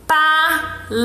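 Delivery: "Palavra" slowly split as pa-la-vra, with clear pauses; teacher breaking "Palavra" into drawn-out syllables. Shown as a demonstration. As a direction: sound out "Palavra" in three separate syllables. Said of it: "minha boca se movimentou três vezes".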